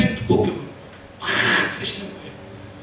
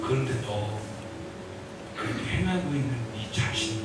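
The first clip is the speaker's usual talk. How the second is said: gentle inner voice; 11 semitones lower, 11 dB quieter than usual. Acted. Quoting the Spirit's gentle word.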